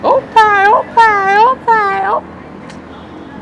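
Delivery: high, slow, drawn-out squeal fading to a breath; imitating drunk girl. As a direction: stretch each Opie way up high, then trail off. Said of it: "she's like".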